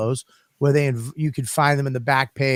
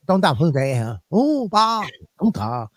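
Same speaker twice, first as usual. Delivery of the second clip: imitating Chinese chatter with a mock angry edge; mock angry Chinese gibberish. Masseuses talking to each other, mock Chinese gibberish. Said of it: "It sounds angry".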